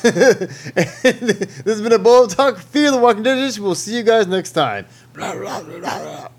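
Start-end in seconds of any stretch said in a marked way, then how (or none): 5.1-6.3 breathy zombie moaning blah; zombie noises. Pretend. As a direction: moan it like a zombie. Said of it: "Zombie noises".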